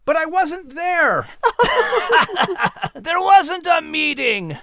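very high, drawn-out protest; high, slow comic objection; protesting character. Acted. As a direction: wail it in a squeaky, far-up voice. Character protests over meeting.

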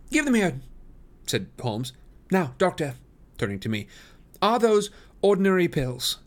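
high, commanding detective; Holmes, brisk. A narrator's reading. Holmes to Lestrade and doctor, high and brisk. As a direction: take charge eagerly.

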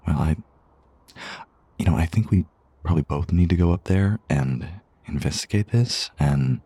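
ordinary talk, nothing marked

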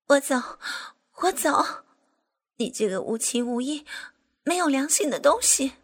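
high, loud parting curse; furious. Acted. Angry woman cursing the heartless official while leaving. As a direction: hurl the insult as you storm out.

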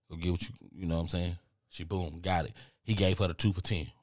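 normal talking voice

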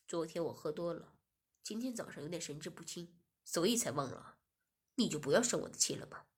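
lightly voiced master pleading his excuse; low master voice. Master excusing himself to Shiya, low and coaxing.

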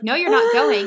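high-pitched mock-firm no; retold parental refusal. Parent refusing child in retold quarrel.

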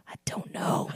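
breathy laughing whisper. Real, not acted. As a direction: giggle it out under breath. Laughing whispered shrug to question.

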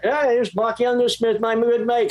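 high mimicked English accent. English producer introducing his good mate, high mimicked English accent. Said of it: "he would call me that".

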